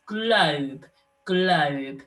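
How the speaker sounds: globe with a long stretched O. Shown as a demonstration. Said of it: "It's got the O sound".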